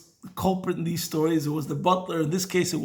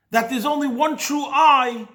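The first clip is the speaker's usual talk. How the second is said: normal talking voice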